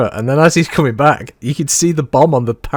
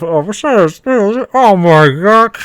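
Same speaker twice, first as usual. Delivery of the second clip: imitating a voice over radio, slow and raised; radio effect; 6 semitones higher, 4 dB louder, much slower than usual. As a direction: mimic a crackly radio cry, drawn out and loud. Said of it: "you hear the little radio effect of".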